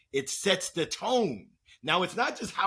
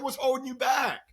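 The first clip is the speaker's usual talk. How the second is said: much higher, punchy coach's callout; coach's challenge; 9 semitones higher than usual. Retold or imitated. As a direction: call him out like a coach. Coach pushing Manan in retold talk.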